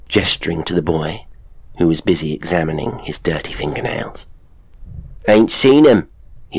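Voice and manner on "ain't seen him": loud, high, curt country lad; boy voice. Acted. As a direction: brush her off without looking up. Boy answers curtly.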